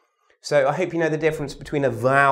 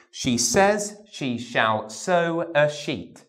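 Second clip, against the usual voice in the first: slow, each "sh" drawn out; slow sh drill; slower than usual. Shown as a demonstration. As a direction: model it slowly for the class to copy. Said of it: "have a go after me".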